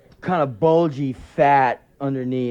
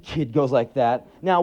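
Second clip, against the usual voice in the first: ordinary talk, nothing marked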